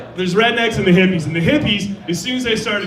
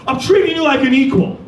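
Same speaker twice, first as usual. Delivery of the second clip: yelling; 6 semitones higher, 4 dB louder than usual. Retold or imitated. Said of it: "I was like".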